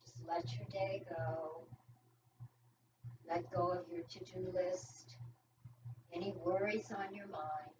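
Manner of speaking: soothing yoga teacher settling the class into stillness; guided relaxation. Acted. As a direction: ease them into letting go, hushed and unhurried. Leads breathing relaxation.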